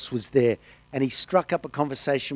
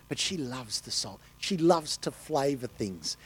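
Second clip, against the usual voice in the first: usual speaking voice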